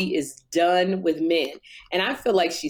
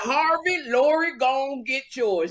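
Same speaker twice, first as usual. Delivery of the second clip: high, exaggerated country host voice; Steve Harvey impression; 5 semitones higher than usual. Co-host calls out to the host's daughter, Steve Harvey impression. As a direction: ham up a country game-show host. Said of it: "That ain't what Steve sound like".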